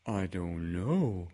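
slow, doleful bear voice; a let-down bear, sighing it. A narrator's reading. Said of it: "rather dolefully".

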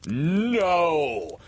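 high, drawn-out scornful no. A narrator's reading. Peter rejecting the guess that he's a man, scornful. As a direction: let it ring out with scorn. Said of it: "answer rang out scornfully".

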